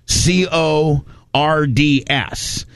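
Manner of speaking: C O R D S spelled letter by letter, slowly; slow spelling of C O R D S. Shown as a demonstration. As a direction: spell out C O R D S one letter at a time. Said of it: "is determined in cords".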